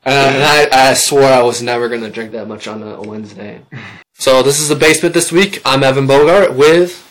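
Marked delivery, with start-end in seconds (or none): 4.2-7.1 radio host sign-off. Real, not acted. Show sign-off.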